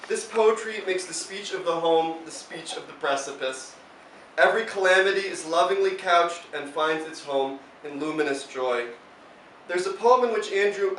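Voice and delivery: measured, emphatic tribute read aloud; formal introduction. Real, not acted. Host introducing a poet to the audience, formal tribute. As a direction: present the poet with deliberate, rounded phrases.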